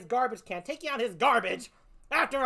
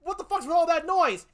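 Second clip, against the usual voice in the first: high, loud yell of an annoyed neighbour; angry neighbour; 7 semitones higher, 7 dB louder than usual. Retold or imitated. Neighbour yelling at the noise outside, loud annoyed shout. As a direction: bark it out like a man disturbed at home.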